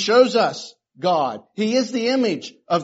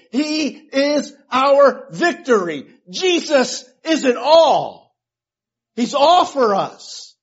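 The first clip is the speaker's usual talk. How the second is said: preaching at full force; 5 dB louder than usual. Acted. Preaching crescendo on who Jesus is.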